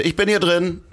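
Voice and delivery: high called-out warning; calling out from toilet. Retold or imitated. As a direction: call through the closed door. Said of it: "dass er sagte".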